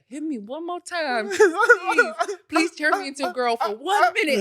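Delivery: high, pleading mock warning with laughter; imitating a playful threat, cracking up. Retold mock threat while laughing.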